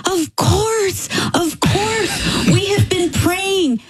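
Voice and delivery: high, slow, overwhelmed thanks; son-in-law thanking God for his mother-in-law, high and slow; overjoyed relief. Retold or imitated. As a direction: pour out relief and gratitude. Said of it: "He goes".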